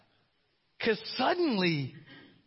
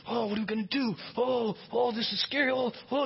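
mock panic; 5 semitones higher than usual. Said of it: "you're like this".

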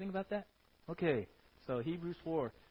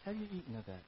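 soft, gentle call fading to a hush; compassionate God; 11 dB quieter than usual. Acted. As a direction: coax him gently to confess. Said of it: "It was grace. It was compassion".

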